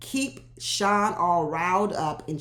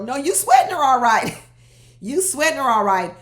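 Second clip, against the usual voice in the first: loud, high, emphatic comeback; loud teasing contradiction; 5 semitones higher, 8 dB louder than usual. Real, not acted. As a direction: call him out, loud and high. Loudly contradicting Sean's retold claim.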